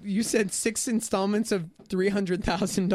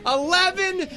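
game show host; 7 semitones higher, 5 dB louder than usual. Acted.

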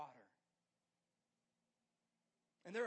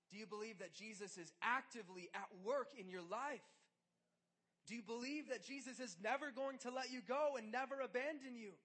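louder, higher fervent appeal; rising preaching; 5 semitones higher, 5 dB louder than usual. Acted. Preacher pressing the congregation about faith. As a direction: build the questions to a climax. Preaching builds to full force.

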